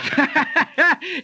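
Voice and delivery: a real amused chuckle; laughing at the buckshot joke; hearty laugh. Real, not acted. Laughs at Mouthy's wound.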